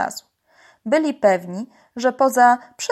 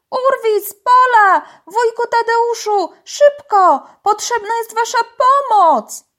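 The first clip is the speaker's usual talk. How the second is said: very high, urgent shouting; loud hollering; 13 semitones higher, 8 dB louder than usual. A narrator's reading. Tola summons the divers back, high loud shouting. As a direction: holler each name across the water for help. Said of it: "Robiła to tak głośno".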